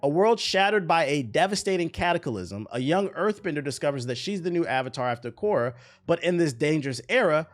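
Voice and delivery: trailer-style read, key words stretched; dramatic synopsis read. Real, not acted. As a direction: read it like an epic series teaser, voice lifted. Reads the show synopsis dramatically.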